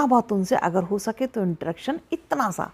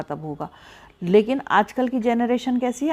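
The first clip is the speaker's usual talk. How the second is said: ordinary talk, nothing marked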